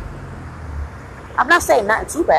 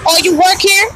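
higher, louder mock-dumb customer question; 7 semitones higher, 5 dB louder than usual. Cashier mocking a shopper who asks if she works there, higher and louder. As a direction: ape the customer asking the obvious, higher and louder.

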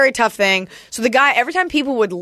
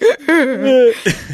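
mock sobbing, higher-pitched; imitated crying; 4 semitones higher, 4 dB louder than usual. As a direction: fake the sobs of a crying audience member. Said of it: "I'm here like".

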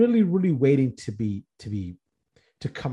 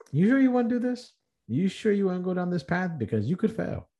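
lightly voiced inner doubter, pitched higher; doubting inner voice; 7 semitones higher than usual. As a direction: needle yourself with a nagging, higher doubting voice. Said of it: "That's the side that says".

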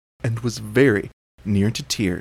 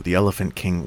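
usual speaking voice

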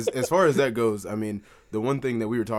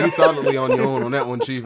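laughing; 3 semitones higher, 9 dB louder than usual. Retold or imitated. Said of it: "I was like".

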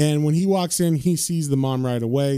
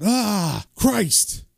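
high-pitched yelp, no real volume; a held-back cry of shock; mock yell; 6 semitones higher than usual. John shocked by released spirit energy, mock yell. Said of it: "physically yells out".

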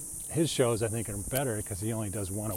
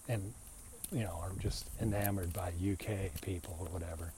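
usual speaking voice

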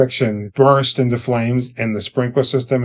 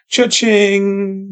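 high, gleeful cha-ching; 8 semitones higher than usual. Retold or imitated. Grandfather gloating to the boy, high and gleeful. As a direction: crow it like a jackpot win. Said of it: "He's like".

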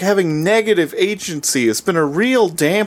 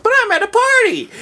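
very high mock Shinji whine; babyish whine; faster than usual. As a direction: protest like a hammed-up helpless baby. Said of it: "he's just such a baby".

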